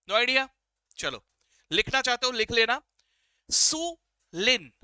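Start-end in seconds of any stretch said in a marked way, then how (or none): 3.5-4.7 सु लिन slow, two clear separate words; separated syllables. Shown as a demonstration. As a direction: pause between सु and लिन so it is not insulin. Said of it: "इंसुलिन नहीं बोला मैंने".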